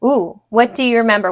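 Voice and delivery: ordinary talk, nothing marked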